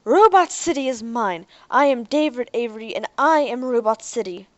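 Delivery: loud triumphant proclamation; shout. A narrator's reading. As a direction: Derek shouts to the robot army, loud and triumphant. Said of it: "He raised his head and shouted".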